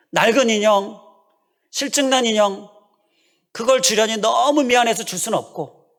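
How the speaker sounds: put-on a small child agonizing over which doll to give; torn child. Child's torn inner thoughts.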